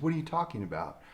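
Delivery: soft, level answer to a heckler; calm reply. Retold or imitated. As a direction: answer quietly, as if nothing is odd.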